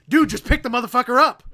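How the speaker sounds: imitated fed-up outburst. Retelling an actor dropping his accent, mock fed-up outburst. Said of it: "He just completely drops that whole accent".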